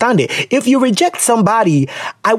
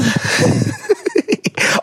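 squeaky little run of laughter; high giggle. Real, not acted. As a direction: giggle helplessly under your breath. Amused reading on.

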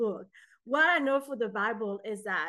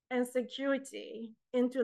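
ordinary talk

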